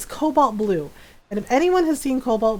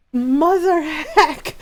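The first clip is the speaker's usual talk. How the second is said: mock exasperation; 5 semitones higher, much slower than usual. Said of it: "I'm like".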